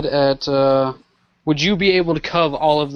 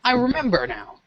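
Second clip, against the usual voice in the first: sudden realization; 4 semitones higher than usual. Acted. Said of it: "She's like".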